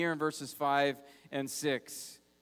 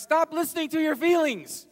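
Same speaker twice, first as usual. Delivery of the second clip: higher and louder, commanding self-talk; urgent self-rebuke, raised and forceful; David preaching to himself; 5 semitones higher, 5 dB louder than usual. Retold or imitated. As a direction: shout the truth at your own soul. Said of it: "grabs himself and takes himself aside, says".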